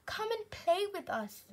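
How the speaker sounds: high, bright call to play; inviting fish friends. A narrator's reading. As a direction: coax him over cheerfully. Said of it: "they would call".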